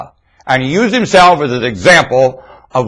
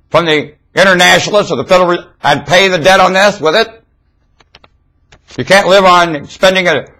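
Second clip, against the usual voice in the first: preaching at full force; 4 semitones higher than usual. Acted. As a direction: hammer the congregation with every phrase.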